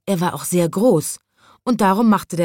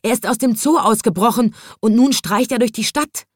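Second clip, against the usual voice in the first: higher, urgent report; excited policeman; 6 semitones higher than usual. A narrator's reading. Policeman warning the wizard, high and urgent. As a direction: warn everyone in a hurry. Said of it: "schrie der Polizist".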